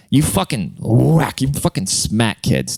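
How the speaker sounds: normal talking voice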